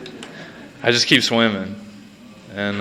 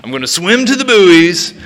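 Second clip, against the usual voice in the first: mock grand vision; 6 semitones higher than usual. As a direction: pitch it up like a man sure the skies will open. Acted grand fantasy before the swim.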